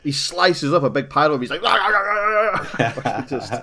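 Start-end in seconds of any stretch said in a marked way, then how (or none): 1.6-3.2 high-pitched, repeated wild battle noise; manic mock roar. Podcaster acting out a character slicing up zombies, manic mock roar. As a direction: imitate someone going mental with knives. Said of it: "he goes mental with those knives".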